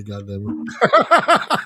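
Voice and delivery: talking through a mouthful of candy; words muffled around candy; mouth full. Real, not acted. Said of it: "mouthful of candy".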